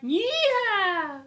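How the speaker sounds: high, loud, drawn-out southern whoop; over-the-top rodeo cheer; mock cowgirl holler. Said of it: "She's going like".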